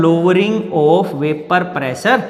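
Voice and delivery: very slow, word by word, for students to write; slow dictation. Shown as a demonstration. Chemistry teacher dictating the definition to the class, very slow. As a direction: spell it out slowly for note-taking.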